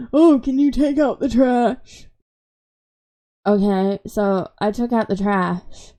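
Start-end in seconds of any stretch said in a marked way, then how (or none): 0.1-2.1 mock grandma calling out, a bit higher; imitating grandma. Retelling grandma asking her to take out the trash, mock call. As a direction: put on a nagging grandma voice calling from another room. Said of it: "my grandma was like".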